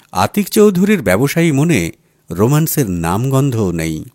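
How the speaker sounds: ordinary delivery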